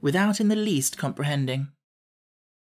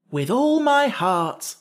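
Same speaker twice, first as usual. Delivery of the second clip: high, slow, emphatic agreement; eager exclamation; 8 semitones higher, 4 dB louder, slower than usual. A narrator's reading. Jack accepting the king's friendship, exclaiming. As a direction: agree wholeheartedly, drawing it out. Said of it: "exclaimed Jack".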